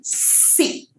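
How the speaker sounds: a soft voiceless hiss of सी. Shown as a demonstration. Teacher isolating the s sound for students. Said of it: "वो stress मालूम पड़ना चाहिए".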